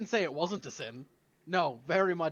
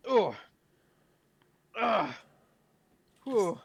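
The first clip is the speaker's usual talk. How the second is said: recovering groans; 5 semitones higher, 7 dB louder, much slower than usual. Real, not acted. Recovering after choking.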